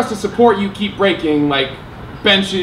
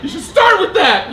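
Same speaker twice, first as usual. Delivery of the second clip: shocked laugh; 11 semitones higher, slower than usual. Retold or imitated. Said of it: "And I was like".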